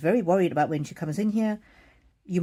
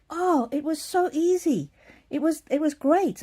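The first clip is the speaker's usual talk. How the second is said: much higher, slow, beaming mother-in-law; delighted old lady; 10 semitones higher, 3 dB louder, slower than usual. Retold or imitated. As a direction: draw out how easy it was, delighted. Mother-in-law reporting her haircut.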